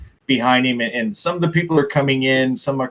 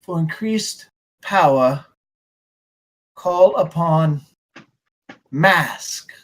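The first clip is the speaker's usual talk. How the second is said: reading an inscription; 4 semitones higher, much slower than usual. Acted. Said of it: "It says".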